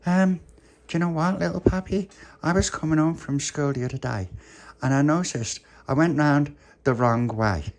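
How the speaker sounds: a hint of a chatty child; Pauline telling news. Pauline tells puppy her news.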